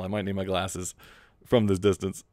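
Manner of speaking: usual speaking voice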